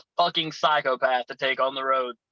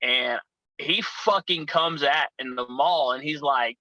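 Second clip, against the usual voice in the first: normal talking voice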